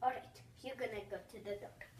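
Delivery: normal talking voice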